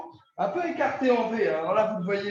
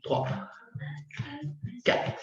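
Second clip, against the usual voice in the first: steady coach counting reps aloud; workout rep count; 4 semitones lower, slower than usual. Real, not acted. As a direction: count each rep, measured beat. Coach counts reps through the set.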